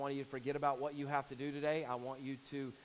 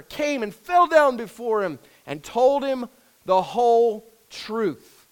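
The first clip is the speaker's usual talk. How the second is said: raised, louder solemn Bible reading; scripture reading; 6 semitones higher, 12 dB louder than usual. Acted. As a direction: read the King James text out to the church. Reads scripture aloud.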